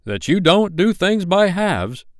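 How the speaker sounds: high, slow, pointed grumble; grudging. A narrator's reading. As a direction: draw it out in a grudging singsong. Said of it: "a grudging remark".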